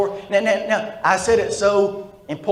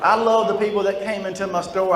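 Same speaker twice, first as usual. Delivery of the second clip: normal talking voice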